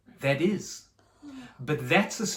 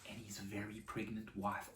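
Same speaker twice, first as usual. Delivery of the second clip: soft, lower, reverent narration; hushed storytelling; 4 semitones lower, 4 dB quieter than usual. A narrator's reading. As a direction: draw the children in quietly.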